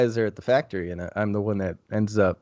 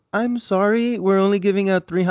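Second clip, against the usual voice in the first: much higher, put-on Filipino accent, cut off midway; Filipino accent, abandoned; 8 semitones higher than usual. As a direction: start a Filipino accent, then drop it. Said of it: "I'm not gonna do the accent".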